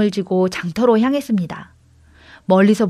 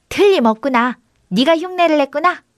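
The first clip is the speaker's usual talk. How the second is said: higher echo of an exclamation; lightly voiced copycat goat; mimicking goat; 6 semitones higher than usual. A narrator's reading. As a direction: parrot the exclamation as a talking goat. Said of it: "염소가 입을 나불거리며 그대로 흉내를".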